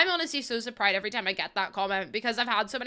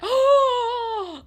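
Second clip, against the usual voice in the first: high, loud breathy oh; starstruck gasp; 13 semitones higher, 8 dB louder than usual. Retold or imitated. Spotting the guy with the fez, high startled oh. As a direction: gasp in shy excitement.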